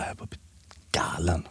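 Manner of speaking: a sudden breathy whisper to finish the story. Retold or imitated. Recalling being driven mad by flutes, whispered. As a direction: whisper galen, unvoiced and soft.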